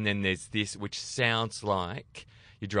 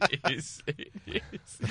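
laughing; 8 semitones higher, slower than usual. Real, not acted. Laughing after being told off.

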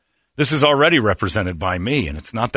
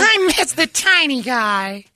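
slow high whiny imitation; high sad woman voice; slower than usual. Imitating wife missing him.

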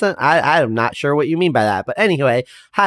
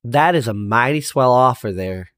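normal talking voice

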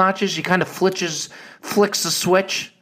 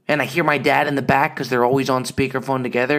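usual speaking voice

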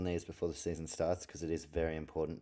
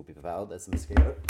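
attention suddenly snatched mid-sentence; distracted by a mosquito; 13 dB louder than usual. Real, not acted. Host spotting the buzzing mosquito mid-preview, suddenly distracted. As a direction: lose the thread as you spot your target. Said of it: "you could hear it in my voice".

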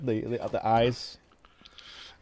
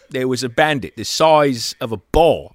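loud, hammed-up British voice; Michael Caine impression; 5 semitones higher, 11 dB louder than usual. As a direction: ham up a Michael Caine anecdote. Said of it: "Everyone do their best Michael Caine impression".

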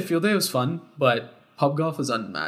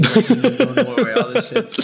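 high laughing, slow words; 10 semitones higher, 6 dB louder, slower than usual. Real, not acted. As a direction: laugh it out in disbelief. Laughing about drunk confusion.

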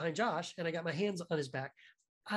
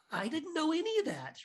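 amazed; 10 semitones higher than usual. Retold or imitated. Amazed retelling own reaction.

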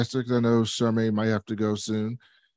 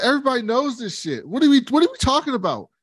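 high, fast exasperated voice; high quick exasperated rant; mock exasperated son; 10 semitones higher, faster than usual. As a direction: rattle it off like a fed-up son.

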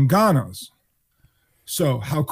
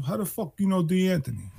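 tough club owner; 5 semitones higher, 5 dB quieter than usual. Retold or imitated. Mobster club owner challenges kids.

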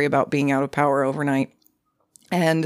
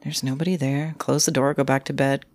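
ordinary delivery

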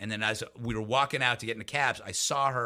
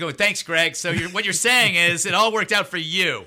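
sarcastic thanks; 4 semitones higher, 6 dB louder than usual. Retold or imitated.

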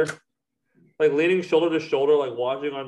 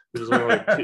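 voice cracking; 10 semitones higher, 3 dB louder, faster than usual. Real, not acted. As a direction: voice cracks up high. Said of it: "excuse my voice crack".

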